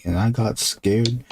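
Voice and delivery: usual speaking voice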